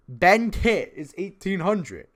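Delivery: loud, high, drawn-out hype; excited. Real, not acted. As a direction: stretch it out in disbelief. Hyped about a cheap player.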